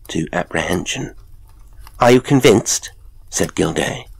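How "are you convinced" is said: raised, louder, testy question; rather irritable. A narrator's reading. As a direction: press a friend with some irritation. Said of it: "rather irritably".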